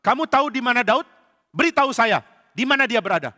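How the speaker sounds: excited king demanding answers; eager king. Acted. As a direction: press him for the news. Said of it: "Saul senang sekali".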